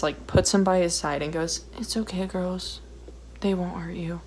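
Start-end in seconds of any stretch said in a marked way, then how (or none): 1.8-4.2 softly hushed imitation of a protective father; a hint of a condescending dad murmuring; mock soothing dad. Teller mocking the dad calming his girls, quiet and hushed. Dad comforting daughters, mocked.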